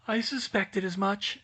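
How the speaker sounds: plain reading voice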